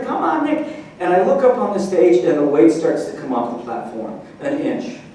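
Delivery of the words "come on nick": imitated crowd cheer, pitched way up; crowd screaming. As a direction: call it out like the whole hall urging him. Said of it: "screaming and screaming".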